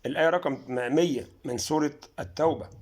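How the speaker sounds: ordinary talk, nothing marked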